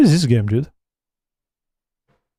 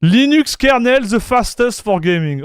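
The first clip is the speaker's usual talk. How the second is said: ordinary talk, nothing marked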